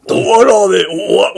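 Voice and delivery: loud echoed battle cry; anime shout. Acted. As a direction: shout it back, hammed-up.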